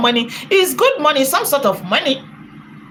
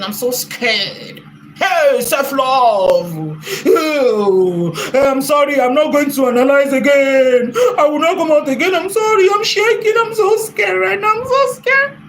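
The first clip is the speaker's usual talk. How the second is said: mock terrified wailing; 3 semitones higher than usual. Mocking the rival who scolded her, with fake terrified sobbing. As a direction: pretend to be a sobbing, shaking coward, rising to a shriek. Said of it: "I'm now shaking".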